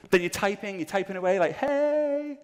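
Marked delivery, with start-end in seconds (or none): 1.5-2.4 high, drawn-out hello; flirty greeting. Acted. Typing a first message to a new match, mock flirty. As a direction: stretch it out high, coyly putting it on.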